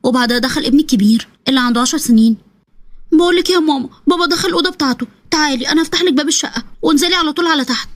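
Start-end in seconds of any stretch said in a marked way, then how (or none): 3.1-7.9 put-on higher, urgent boy's voice; a little boy whispering an escape plan; young son. Son urging his mother to flee, high eager child voice.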